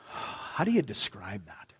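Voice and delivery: hushed, slow whisper of wonder; awed whisper. Acted. Pastor marvelling at God's glory, whispering slowly. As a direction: breathe it out as if words fail.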